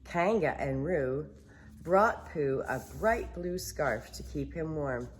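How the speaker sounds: ordinary delivery, nothing marked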